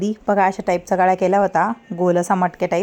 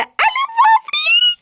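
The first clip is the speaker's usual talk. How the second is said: playful exclaim; 4 dB louder, much slower than usual. Acted. Mother waking baby, playful exclaim.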